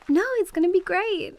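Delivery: high, loud mock enthusiasm; playful teasing. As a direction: oversell how great it'll be. Teasing about the old cabin.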